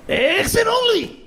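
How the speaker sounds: high mock shriek of protest; squeaky mock yelp. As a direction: squeal it out slowly. Tattoo joke punchline.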